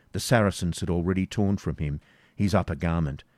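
ordinary delivery

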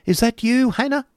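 high called question from another room; a high lady's call through a doorway; calling out as Miss La Creevy. A narrator's reading. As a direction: call out high and fluting, unseen. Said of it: "cried a voice from Miss La Creevy's".

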